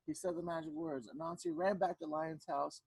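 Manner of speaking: ordinary delivery, nothing marked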